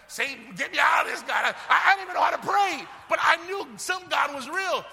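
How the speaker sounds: loud, high preacher's cry; desperate retold plea. Retells desperate prayer while hiding.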